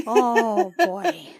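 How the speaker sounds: loud, rising groan; overwhelmed outburst. Real, not acted. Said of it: "player overwhelmed".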